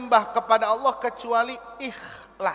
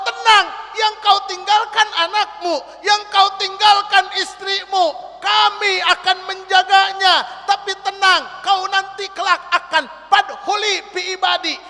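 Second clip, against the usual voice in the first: voice of reassurance; 8 semitones higher, 4 dB louder than usual. Acted. God's voice reassures the servant.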